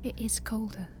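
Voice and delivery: hushed eerie whisper. Acted. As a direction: whisper it into the dark. Whispered during séance.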